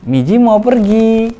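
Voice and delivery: high, loud, very slow bye-bye to the car; sing-song goodbye. Shown as a demonstration. Parent saying goodbye to the toy car, high slow sing-song. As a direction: sing it out gently to a toddler. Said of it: "Komunikasi kita cukup di".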